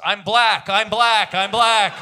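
chanted mock scream, building to a laugh. Host imagining the couple on a psychedelic retreat, mock scream. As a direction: yell it out like tripping in the forest. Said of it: "scream in the Northern California forest".

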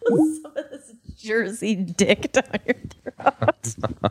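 slow, laughing repeat of the line. Real, not acted. Co-host repeating the crude line, laughing slowly. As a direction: savor the joke through laughter.

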